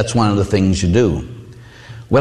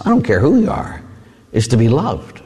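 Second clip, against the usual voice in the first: ordinary talk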